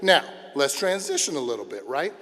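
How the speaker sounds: ordinary talk, nothing marked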